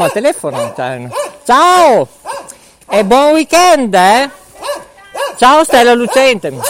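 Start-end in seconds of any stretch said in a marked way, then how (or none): 1.4-6.6 higher, louder farewell called out; calling goodbye. Real, not acted. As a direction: shout the goodbye across the street. Shouting goodbye to passersby. (saluto gridato ad alta voce)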